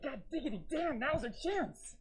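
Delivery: zany high-pitched grandpa; goofy high voice. Retold or imitated. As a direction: squeak it out like a cartoon grandpa. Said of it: "That's how he talked".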